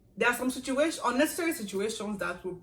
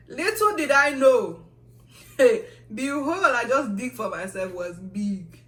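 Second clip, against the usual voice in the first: dramatic foreshadow; 4 semitones higher, 11 dB louder, slower than usual. Real, not acted. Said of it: "dramatic foreshadowing".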